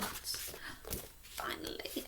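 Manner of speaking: breathy hushed whisper. Real, not acted. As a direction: whisper it to the class. Whispered aside in phonics lesson.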